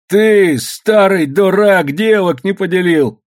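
high, loud, drawn-out scolding; angry berating. Acted. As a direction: lay into the old fool. Daughter berates brawling father.

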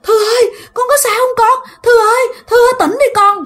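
very high sobbing call; mother crying. Retold or imitated. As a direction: plead tearfully for your child to wake. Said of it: "mẹ của bạn". (mẹ khóc gọi con, giọng rất cao)

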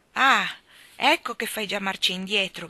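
sudden loud cry from the fairy; fairy cries out. Acted. Fairy crying out at Ermanno, loud and accusing. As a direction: catch him backing down, loudly. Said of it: "gridò la fata".

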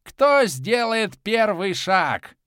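high, loud, slow taunting madman; mad god. A narrator's reading. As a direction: taunt them like a crazed child. Mad god taunts both armies.

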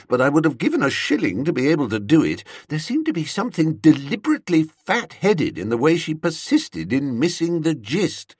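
plain reading voice

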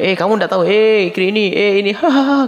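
high, teasing gossip ending in a giggle; mocking laugh. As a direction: mimic someone ridiculing a friend, laughing. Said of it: "kalau ada orang ngejek temannya". (mengejek sambil tertawa dengan nada tinggi)

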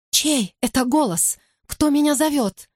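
put-on frightened high child voice.

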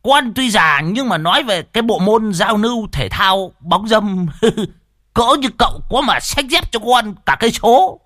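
high, loud bragging character voice; boastful old driver. Acted. Old driver brags to his mates about his sporting skill, boastful.

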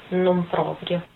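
ordinary delivery

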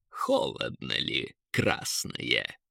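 low, slow, soft taunt; taunting eagle. A narrator's reading. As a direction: repeat the tease deep and unhurried. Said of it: "опять повторил Эрвин". (низкий насмешливый голос орла)

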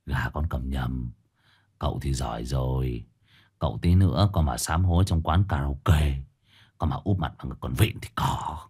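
low, quiet sarcastic jab; a low, sly comeback to his master; sly retort. Acted. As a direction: mutter back a cheeky comeback. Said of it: "cũng không kém cạnh mà đáp".

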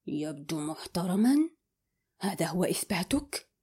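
ordinary delivery

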